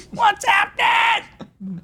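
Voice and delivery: very high, stretched-out shout; mock crowd hype. Comic working an imagined Sydney crowd, slow high shout. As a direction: yell it slowly in a squeaky pitch. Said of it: "I'll be like".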